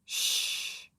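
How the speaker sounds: soft unvoiced shush; a baby hushing everyone; whispered shh. A narrator's reading. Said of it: "said the baby".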